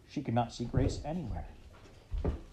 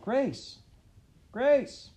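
high urgent call, louder on the second; 14 semitones higher, 5 dB louder than usual. A narrator's reading. Ma calling for her lost daughter, high and loud. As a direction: call out loud for the missing child. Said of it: "And she called".

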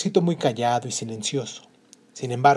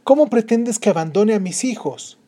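upset mother; 8 semitones higher, 5 dB louder than usual. Acted. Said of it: "le dijo ella".

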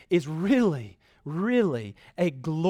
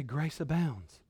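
soft, lowered preaching voice; hushed assurance; 3 semitones lower, 8 dB quieter, slower than usual. Real, not acted. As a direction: let the refrain fall quiet.

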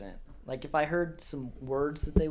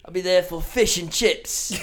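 mock-English pub regular; hammed-up English; English accent; 3 dB louder than usual. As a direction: announce it in a put-on English accent. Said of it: "He knew pub was English".

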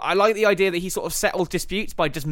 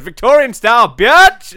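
triumphant yell; 7 semitones higher, 13 dB louder than usual. Retold or imitated. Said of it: "She goes".

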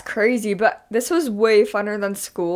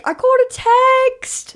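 high, slow mock Australian squeal; a playful Australian reality-show voice; Australian accent; 5 dB louder, slower than usual. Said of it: "talking in Australian accents".

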